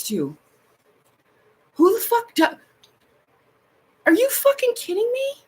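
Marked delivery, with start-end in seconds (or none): 1.7-5.3 much higher, incredulous; incredulous outrage. Real, not acted. Reacting to the grandma post, high incredulous outrage. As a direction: pitch up in can't-believe-it outrage.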